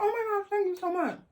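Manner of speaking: high giddy thank-you; gushing thanks. Retold or imitated. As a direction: gush like a flattered girl. Thanks strangers for compliment.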